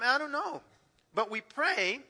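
usual speaking voice

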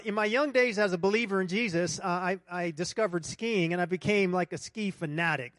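normal talking voice

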